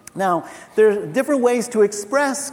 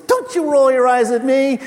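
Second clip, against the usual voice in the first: raised, louder imitation of outrage; mock scolding; 5 semitones higher, 8 dB louder than usual. Backstage worker to an eye-rolling colleague, mock scolding. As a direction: call someone out in mock indignation.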